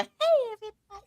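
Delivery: put-on squeaky high girly greeting; mock a high-pitched girly hello; bubbly high-voiced girl saying hi. Shown as a demonstration. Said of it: "high-ass girly voice".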